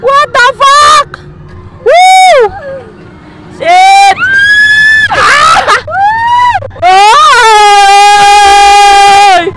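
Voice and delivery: high, drawn-out shrieked curses and whoops; full-voiced thrill-ride yelling; real ride screaming. Real, not acted. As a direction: scream your head off on the ride.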